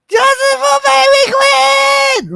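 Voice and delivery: high, slow, sing-song mock wail; mother's fake-crying chant for the baby. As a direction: ham up a tearful chant, high and drawn out. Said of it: "she's crying and she's like".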